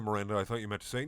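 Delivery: usual speaking voice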